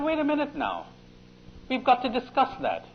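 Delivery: a fussy small animal insisting; rabbit. Retold or imitated. Rabbit stops the crocodile, high and insistent. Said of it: "He said".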